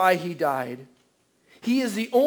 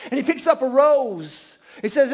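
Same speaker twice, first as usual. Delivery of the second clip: usual speaking voice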